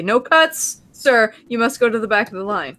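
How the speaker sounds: usual speaking voice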